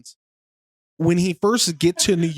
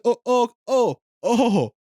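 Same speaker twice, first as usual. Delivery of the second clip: high-pitched Borat-style oh's; a string of startled, higher oh's as it sinks in; dawning realization; 7 semitones higher, slower than usual. Acted. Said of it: "He realized he, he's, he's on T V".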